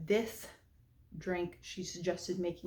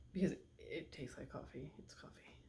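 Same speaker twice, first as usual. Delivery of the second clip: whisper; 4 semitones lower, 13 dB quieter than usual. Real, not acted. Whispered aside after tasting.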